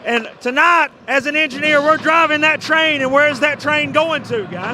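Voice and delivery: loud rally-the-crowd host; hype announcer. Acted. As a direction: pump up the crowd, full volume. Hype host at the fan section.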